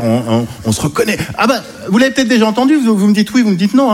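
ordinary delivery

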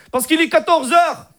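put-on high Moroccan-accented French; Hicham. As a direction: explain it in a comic Moroccan accent. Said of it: "l'accent arabe".

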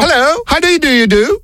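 anglais de pacotille, haut perché; faux patron anglais. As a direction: se faire passer pour un patron anglais. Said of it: "je vous passe le patron". (faux patron anglais, voix aiguë)

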